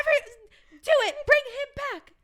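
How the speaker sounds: high, loud, pleading cry; desperate demand. Acted. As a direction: urge them to save him. Joining the desperate plea.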